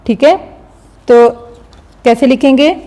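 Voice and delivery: ordinary delivery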